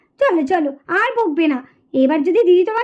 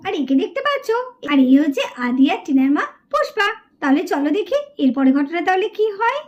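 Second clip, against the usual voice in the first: much lower, calm storyteller voice; story narration; 6 semitones lower than usual. A narrator's reading. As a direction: introduce the mother to the listeners. Narrator introduces mother. (গল্প বলার শান্ত ভঙ্গি)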